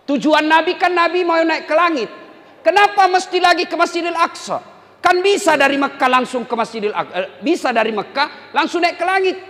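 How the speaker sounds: high, loud insistent questioning; inquisitive student. Retold or imitated. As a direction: press the teacher with questions. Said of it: "Mahasiswaku di Unhas bertanya". (mahasiswa bertanya kepada ustadz dengan nada tinggi)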